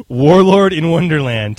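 slow, loud echo of the title; announcer voice. Acted. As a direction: boom the title like a trailer. Host echoes title grandly.

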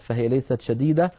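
usual speaking voice

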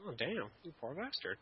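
ordinary talk, nothing marked

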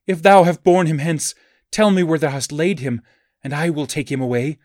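distraught woman crying out to a stranger; exclaimed. A narrator's reading. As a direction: burst out begging, pitched well up. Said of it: "she exclaimed".

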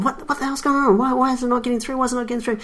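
high, pleading repeated why; whiny mock complaint; mock exasperation. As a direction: customer retelling his nagging calls to support. Retold complaint to Dell.

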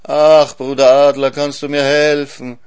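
pitiful moaning. A narrator's reading. Suffering hyena begging the eagle for help, pitiful moaning. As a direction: wail for help as a wretched hyena. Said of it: "die Hyäne stöhnt ganz jämmerlich".

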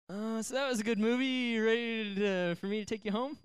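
high-pitched, sheepish imitation of the boyfriend; awkward date. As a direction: stall awkwardly, stretched out and high. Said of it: "it's like".

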